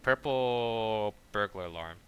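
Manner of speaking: PURPLE stretched long before burglar alarm; drawn out. Shown as a demonstration. Streamer testing a hard phrase, drawn out. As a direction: say "Purple burglar alarm" slowly, dragging out the vowels. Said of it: "let me see if I could say it".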